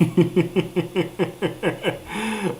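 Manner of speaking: long run of soft, high giggles; real giggle. Real, not acted. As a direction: giggle helplessly in a quick pulsing run. Laughs at own remark about being busy.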